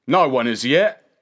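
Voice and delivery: loud character voice of a coachman; gruff coachman. A narrator's reading. Coachman answers the boy, blunt character voice.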